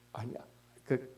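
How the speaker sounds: usual speaking voice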